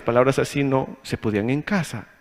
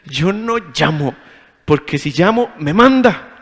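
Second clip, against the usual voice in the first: rising higher and louder to an exasperated end; reluctant excuse; 3 semitones higher, 4 dB louder, slower than usual. Retold or imitated. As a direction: build from grumble to fed-up protest. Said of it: "decía yo".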